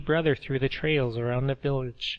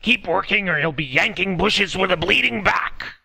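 loud, threatening command; guard menacing the old woman; annoyed guard; 7 semitones higher, 8 dB louder than usual. Acted. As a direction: bellow a threat at the workers.

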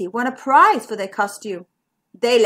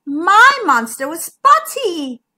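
loud, slow, high insistence; crying out; 5 semitones higher, 7 dB louder, slower than usual. A narrator's reading. Zara describes her monster to Zigbee, crying out. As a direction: insist loudly, drawing the words out. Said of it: "cried Zara".

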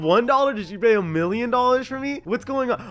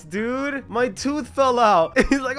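ordinary delivery

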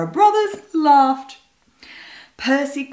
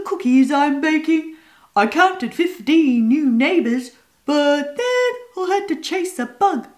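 cat voice; 6 semitones higher than usual. A narrator's reading.

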